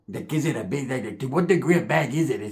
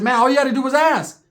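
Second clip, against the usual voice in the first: higher, louder fed-up reply; exasperated; 7 semitones higher, 4 dB louder than usual. Retold or imitated. Storyteller fed up with cousin, exasperated. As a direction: throw up your hands at him.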